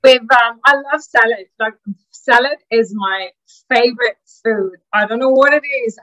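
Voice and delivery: usual speaking voice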